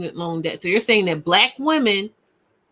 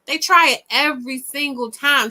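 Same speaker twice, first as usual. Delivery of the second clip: ordinary delivery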